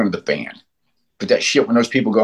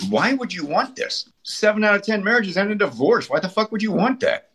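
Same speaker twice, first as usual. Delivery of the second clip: incredulous challenge; 8 semitones higher than usual. Acted. Acted challenge to people's choices.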